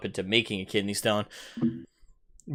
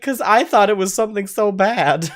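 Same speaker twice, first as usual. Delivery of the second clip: high, loud, giggly relieved sister; 9 semitones higher, 7 dB louder than usual. Retold or imitated. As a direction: sister laughing off her worry. Sister explains her laughing.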